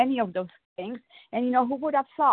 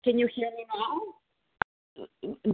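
muffled, tinny, slow phone line; like talking inside a tin can; 2 semitones higher, much slower than usual. Real, not acted. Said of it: "It sounds like you're in a tin can".

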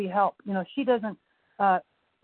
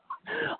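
quick, high-pitched chuckle; short laugh; 8 dB quieter than usual. Real, not acted. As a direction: let out a brief, soft high laugh. Laughs at own divorce analogy.

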